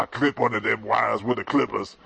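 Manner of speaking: normal talking voice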